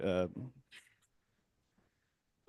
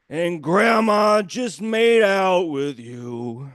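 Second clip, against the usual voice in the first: very slow, louder, higher put-on singer's voice; 4 semitones higher, 4 dB louder, much slower than usual. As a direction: drag each word out like a boozy old balladeer. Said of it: "sounds just like this".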